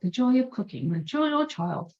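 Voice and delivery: high, sing-song Julia Child imitation; slipping back into a mock Julia Child; Julia Child impression. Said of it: "Yeah, I said I was gonna stop".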